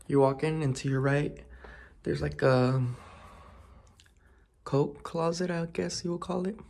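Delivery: ordinary talk, nothing marked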